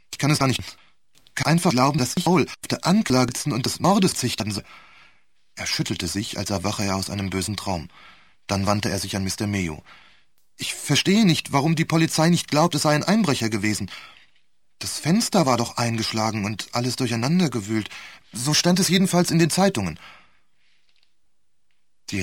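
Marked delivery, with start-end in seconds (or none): 0.1-4.6 hohe, laute Stimme voller Verzweiflung; aufgewühlt. A narrator's reading. Angeklagter fassungslos über drohende Mordanklage. 10.6-20.0 hoch und laut, aufgebracht; erregter Protest. A narrator's reading. Angeklagter protestiert erregt gegen Polizeisicht.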